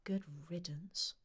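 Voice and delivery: soft whispered aside; under her breath. A narrator's reading. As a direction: shopkeeper mutters as Tom leaves. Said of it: "said the shopkeeper under her breath".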